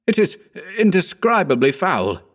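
high-pitched exclamation of disgust; exclaiming. A narrator's reading. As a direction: exclaim it with revulsion. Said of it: "I exclaimed".

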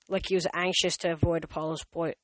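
ordinary delivery, nothing marked

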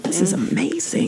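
breathy awed whisper. Retold or imitated. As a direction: whisper in blissful relief. Blissed-out student in relaxed class.

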